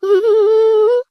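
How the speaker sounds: a high giggle rising at the end. Acted. Daughter-in-law laughs at wrong time. (ऊँची खिलखिलाहट)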